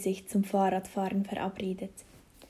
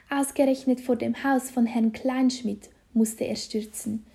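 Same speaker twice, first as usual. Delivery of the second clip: ordinary delivery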